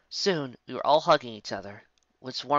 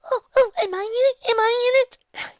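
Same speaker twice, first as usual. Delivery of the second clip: very high, loud eager squeal; excited filly; 11 dB louder than usual. Acted. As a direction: bounce with hope. Excited filly asks about story.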